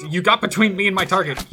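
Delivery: high, loud playful indignation; mock outrage. Player scolds a foe who blocked the target, mock outrage.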